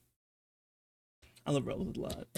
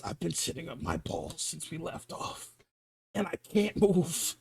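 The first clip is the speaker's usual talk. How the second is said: quiet strained seatmate; 3 semitones lower, 9 dB quieter than usual. Retold or imitated.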